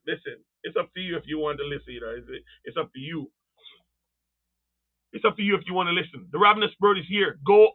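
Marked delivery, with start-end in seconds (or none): 0.1-3.3 soft, low, take-it-or-leave-it delivery; low-key aside. Real, not acted. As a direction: drop right down, leave it to them. Preacher drops into resigned aside.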